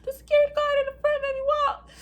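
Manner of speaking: loud, very high-pitched whine; mock crying wail. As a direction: wail it like a hammed-up crybaby. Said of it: "I was crying".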